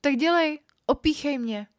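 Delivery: high, loud impatient command; barked order. A narrator's reading. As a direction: order it sharply, pitched well up. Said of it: "dala mi rozkaz".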